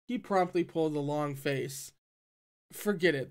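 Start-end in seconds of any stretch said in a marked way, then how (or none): none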